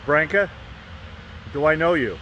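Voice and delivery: lightly voiced Michael, a touch brighter; casual mild Michael imitation; [name] voice. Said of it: "he says".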